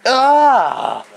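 high, loud cry of alarm; short high-pitched scream; mock scream. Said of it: "This scream".

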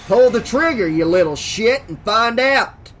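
loud, aggressive dare; goading. Acted. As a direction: taunt him loudly, daring him on. Sheriff goading the armed boy.

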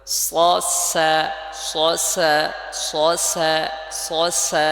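loud rounded heavy স then thin, open সা; pronunciation drill. Shown as a demonstration. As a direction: alternate স and সা in emphatic separate syllables. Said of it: "যাতে আপনারা ধরতে পারেন".

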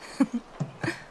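short voiceless chuckle; a quick breathy laugh at the friend's joy; airy laugh. Acted. Amused by a friend's cheer, airy laugh.

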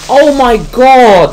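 slow, high, loud groaning cry; exasperated shout. Real, not acted. Gamer annoyed after creeper scare, exasperated shout. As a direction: drag it out in loud frustration.

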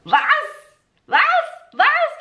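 playing a German who can't understand; baffled German listener. Teacher acts a confused native listener, high and rising. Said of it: "Você não vai conseguir entender".

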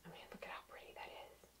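soft whispered aside; whispering. Real, not acted. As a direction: breathe it in a whisper.

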